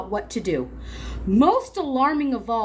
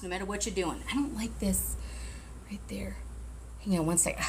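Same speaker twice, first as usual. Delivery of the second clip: faint, off-mic voice; turning away from phone; 3 semitones lower, 10 dB quieter than usual. Real, not acted. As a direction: speak turned away from the mic.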